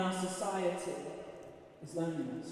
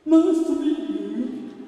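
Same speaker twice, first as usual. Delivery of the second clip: woman's greeting; 6 semitones higher, 11 dB louder, slower than usual. Retold or imitated. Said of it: "she's like".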